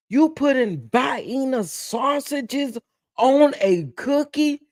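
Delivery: high, slow, outraged cry; scandalised yelp about Vienna sausages on a cookie; appalled shout. Real, not acted.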